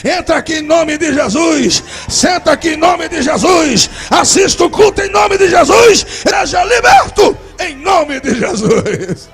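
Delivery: loud, high, slow preaching climax; preaching shout. Acted. Preacher shouts the deacon's welcome to the church. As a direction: build to a shouted altar call.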